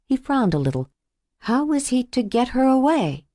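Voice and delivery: ordinary delivery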